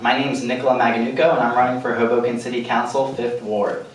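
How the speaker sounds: usual speaking voice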